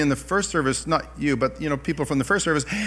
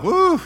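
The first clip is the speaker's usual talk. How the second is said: whoo; 5 dB louder than usual. Retold or imitated. Tears welling up at meeting.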